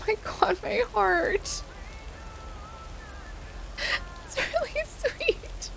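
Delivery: crying through the words, overwhelmed by kindness; real happy tears. Real, not acted. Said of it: "I'm just crying".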